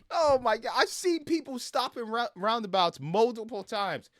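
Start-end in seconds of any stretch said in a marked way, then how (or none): none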